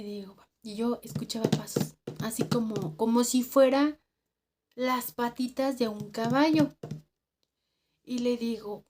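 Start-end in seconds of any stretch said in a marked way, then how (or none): none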